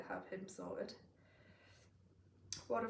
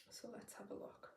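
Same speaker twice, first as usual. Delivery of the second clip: whispered; 2 semitones higher, 11 dB quieter than usual. Real, not acted. Muttering while checking numbers.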